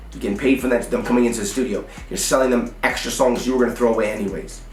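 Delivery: ordinary delivery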